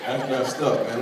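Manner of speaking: normal talking voice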